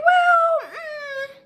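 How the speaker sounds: very high, loud wailing mock voice; mock whiny cry. Mimicking the girl whining to coach, high wailing voice. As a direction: parody her as whiny and fake-upset. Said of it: "she like".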